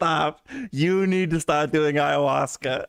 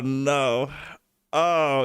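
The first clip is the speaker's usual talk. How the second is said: ordinary talk, nothing marked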